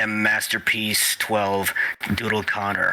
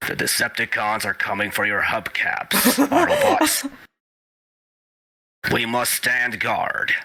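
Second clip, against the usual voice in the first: Optimus Prime voice. Shown as a demonstration. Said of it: "your Optimus voice".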